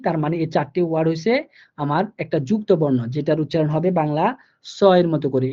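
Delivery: ordinary talk, nothing marked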